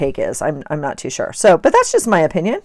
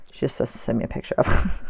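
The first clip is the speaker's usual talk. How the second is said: whisper; 6 semitones lower, 6 dB quieter, faster than usual. Real, not acted. Whispered complaint about her child.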